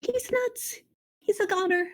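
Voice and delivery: high-pitched panicky mouse voice; Ferlo's alarmed exclaiming. A narrator's reading. Mouse sees his brother is doomed, alarmed exclaiming. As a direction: exclaim way up high without real volume. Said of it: "shouted Ferlo again".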